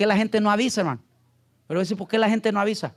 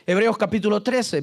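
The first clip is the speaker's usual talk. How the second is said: ordinary talk, nothing marked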